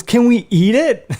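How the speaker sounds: put-on high, loud, slow kid voice; imitated eager daughter. Daughter asks to eat the buck, imitated eager kid.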